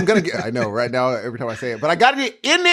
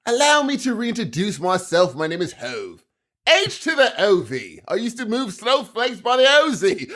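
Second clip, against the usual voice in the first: London [name] recital; 3 semitones higher than usual. Pretend. Imagined London podcasters reading [name] lyrics, mock British accent. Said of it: "Blurs to come on and read [name] lyrics".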